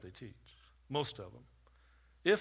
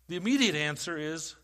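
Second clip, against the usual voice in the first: normal talking voice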